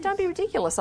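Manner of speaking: high, scoffing "don't be ridiculous"; mock indignant. Author retelling how she dismissed the American editor, in mock indignation. Retold argument with her editor.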